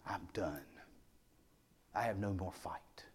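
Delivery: very soft, low, spent; a hushed giving-up; resigned murmur. Retold or imitated. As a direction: barely get the words out, defeated. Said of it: "The afflicted one is resigned".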